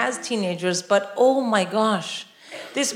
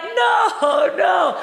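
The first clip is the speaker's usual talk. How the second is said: high, loud panicked no; desperate shout; 8 semitones higher, 3 dB louder than usual. Retold or imitated. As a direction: yell to stop the snakes. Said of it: "you're like".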